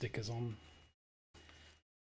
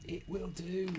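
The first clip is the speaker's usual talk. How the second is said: higher, voiced insistence; 8 semitones higher than usual. Real, not acted. Builder declaring the sticker acceptable, high and voiced. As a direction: say it aloud, high, each word separate. Repeats the verdict aloud, emphatically.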